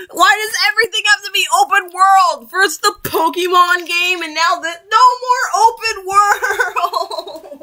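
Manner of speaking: a shrill rising rant that slows at the end; exasperated rant. Real, not acted. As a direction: rant at the game, half laughing. Ranting about open world.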